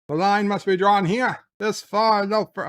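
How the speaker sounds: hammed-up heroic shout; mock defiant shout. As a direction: belt it out like a heroic captain. Dramatic Picard quote, wrong episode.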